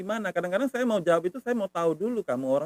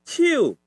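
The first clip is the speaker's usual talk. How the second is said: ciu high and falling; hand tone; 8 semitones higher, 4 dB louder than usual. Shown as a demonstration. Teacher recaps three tones, ciu high falling. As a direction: start ciu high, then drop it. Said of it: "agak tinggi".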